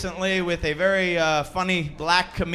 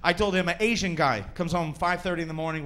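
ordinary talk, nothing marked

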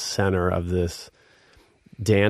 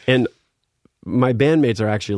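ordinary talk, nothing marked

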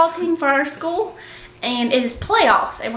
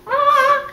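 very high "ah" noise; imitated flailing turtle; 4 dB louder than usual. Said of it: "are all like".

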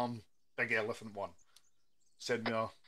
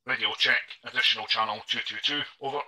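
loud, clipped radio check call; 9 dB louder than usual. Shown as a demonstration. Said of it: "So we'll try that again".

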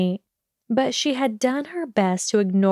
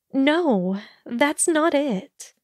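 higher, lightly voiced young heroine protesting; mild protest in a higher character voice; pleading denial; 6 semitones higher, slower than usual. A narrator's reading. Hermione protests after flinching at his accusation.

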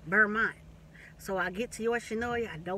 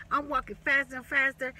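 ordinary talk

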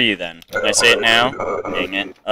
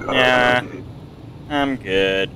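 ordinary talk, nothing marked

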